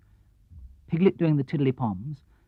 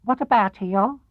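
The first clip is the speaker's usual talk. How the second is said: higher small piglet voice; curious little piglet; 7 semitones higher, slower than usual. Acted. Piglet asks Pooh about Eeyore, high squeaky.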